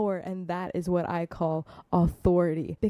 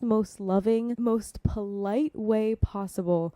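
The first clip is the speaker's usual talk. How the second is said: ordinary talk, nothing marked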